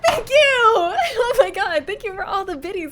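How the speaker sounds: high, loud joyful thanks; excited shouting. Real, not acted. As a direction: squeal thanks for gifts. Donation thanks.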